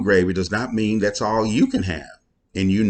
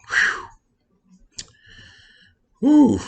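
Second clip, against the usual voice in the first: delighted whew; 4 dB louder than usual. Real, not acted. Raving about wife's corn.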